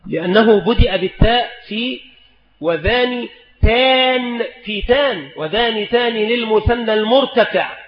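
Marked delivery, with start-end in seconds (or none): none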